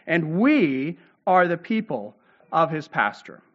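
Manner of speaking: "we" pressed hard and slow at the start; stress on we. Shown as a demonstration. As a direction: lean on "we", then let the line fall slowly. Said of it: "this is emphatic in the Hebrew".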